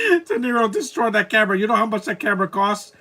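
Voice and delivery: high, laughing burst of amusement; cracking up as the words come out; laughing excitement. Real, not acted. To the YouTube viewers about De Niro's stunt, laughing excitement.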